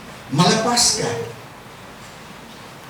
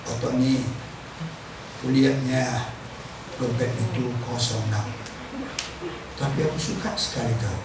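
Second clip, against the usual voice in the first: much lower and softer, fatherly; voice of God; 8 semitones lower, 8 dB quieter than usual. Retold or imitated. God speaking tenderly to a worshipper, low and soft. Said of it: "Tuhan bilang".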